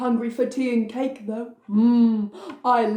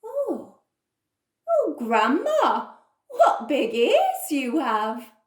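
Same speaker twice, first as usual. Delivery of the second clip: put-on wondering girl; 3 semitones higher, slower than usual. Girl marvels at wolf.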